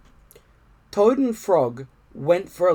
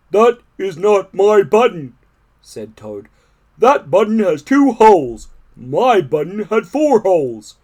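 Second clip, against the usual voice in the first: higher, louder toad voice; grumpy Toad; 5 semitones higher, 8 dB louder than usual. A narrator's reading. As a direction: Toad huffily refusing the button. Toad rejects the button.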